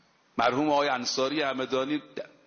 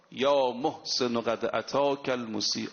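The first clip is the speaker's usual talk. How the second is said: slow Arabic quote; 4 semitones lower, slower than usual. Acted. Quoting Arabic words at the door.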